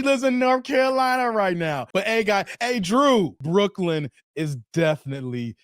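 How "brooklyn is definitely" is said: low, slow, drawn-out delivery; movie line imitation. Host quoting He Got Game to viewers, deep slow imitation. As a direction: drop deep and soft, spacing each word. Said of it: "when he was like".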